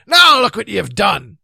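loud, high shout of reproach; angry scolding. Acted. Knight blaming the king for the fall, angry. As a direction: bellow the blame at him.

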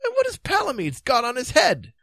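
high-pitched puzzled king; high, dithering character voice; Pellinore, baffled. Acted. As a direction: ask high, in innocent bewilderment.

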